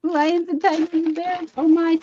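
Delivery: high, silly lines about being distracted; playful distraction. Joking to viewers about her short attention, playful. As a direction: toss out the words like a scatterbrain. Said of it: "I'm distracted".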